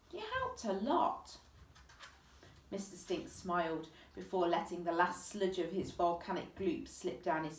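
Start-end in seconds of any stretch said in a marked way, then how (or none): 0.1-1.4 put-on Chloe's girl voice. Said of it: "Chloe thanks Mister Stink".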